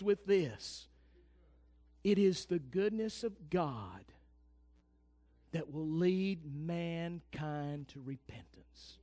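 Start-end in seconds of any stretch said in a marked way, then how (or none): 2.0-9.0 slow, hushed whisper of a sacred truth. Real, not acted. Preacher to congregation, slow soft whisper. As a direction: lean in and breathe the words out slowly.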